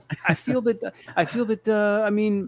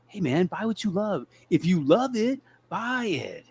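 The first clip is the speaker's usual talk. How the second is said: higher, softer, sneaky sales patter; sneaky salesmanship; 6 semitones higher, 4 dB quieter, slower than usual. Acted. Co-host advising a doubtful show audience, sneaky. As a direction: coax softly in a raised voice, like a sly salesman. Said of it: "kind of like a, a sneaky way".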